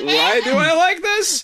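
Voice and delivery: high, drawn-out bewildered voice; hammed-up confused teen. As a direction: squeak it out, baffled. Acting out confused teen arousal.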